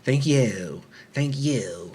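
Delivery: lightly mimicked not-quite-Aussie accent; mimicking odd accent. Critic mocking the comedian's thank you, odd non-Aussie accent. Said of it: "That's not an Aussie accent, bro. What accent's that".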